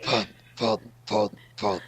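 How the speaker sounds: low, gruff Batman-style fun. Pretend.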